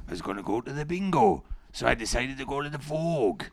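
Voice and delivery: imitated Welsh accent with stretched line endings; mock Welsh, swooping up on the last word. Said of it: "I did do a Welsh accent by accident".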